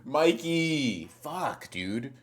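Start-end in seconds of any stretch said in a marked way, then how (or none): 0.1-1.1 higher calling out for Mikey; calling out a name, higher. Acted. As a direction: call for him across the room.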